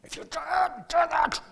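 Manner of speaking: high, loud roar of a furious director; retold director's yell. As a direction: bellow the threat at the actor. Director threatens, voiced in story.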